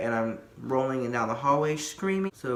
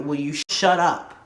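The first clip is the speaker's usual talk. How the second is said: louder, irritated retold outburst; mock exasperation; 2 semitones higher, 5 dB louder than usual. Patient to someone screaming three curtains down, acted exasperation. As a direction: push it out as an annoyed demand.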